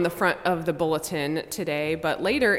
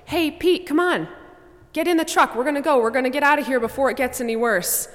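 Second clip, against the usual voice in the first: neighbor shouting from the truck, higher and louder; calling out; 7 semitones higher, 4 dB louder, faster than usual. Acted. Neighbor in a truck calls to Pete, loud and high. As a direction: holler across the floodwater to get him in.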